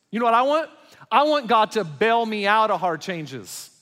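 loud, high pulpit preaching; impassioned sermon delivery; fiery preaching. Acted. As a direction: preach it out with full force. Preaching at full force.

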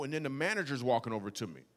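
ordinary talk, nothing marked

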